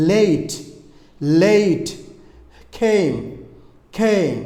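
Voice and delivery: "লেট" and "came" with stretched ay-ee, falling; each word drawn out and set apart; long ay vowel. Shown as a demonstration. Teacher drills A plus consonant plus E, long gliding vowel. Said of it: "এখানে আমরা একটা word বলতে পারি".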